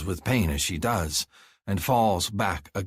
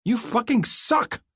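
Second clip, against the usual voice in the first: much higher, slow furious woman's shriek; furious insult; 3 dB louder, slower than usual. A narrator's reading. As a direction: hurl the insult at him. Heroine insults him in fury.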